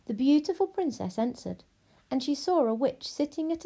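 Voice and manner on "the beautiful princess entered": ordinary delivery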